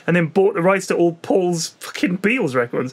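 normal talking voice

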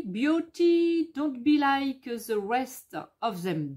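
reading English slowly with a thick French accent; sounding out English words one by one, French-style. Real, not acted. Vlogger reading sticker words aloud, in heavy French-accented English. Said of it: "Avec mon accent de, de zéro".

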